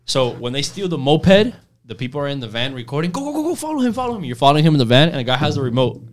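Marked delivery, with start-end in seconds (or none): 3.1-4.2 high, softer urgent calls; hushed urgent chase. Retold or imitated. Pranksters in the van urging the chase, high and hushed.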